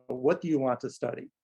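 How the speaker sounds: ordinary talk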